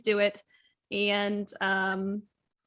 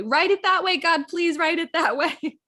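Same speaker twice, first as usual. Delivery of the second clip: pleading; 6 semitones higher, 6 dB louder than usual. Real, not acted. Pleading with writers.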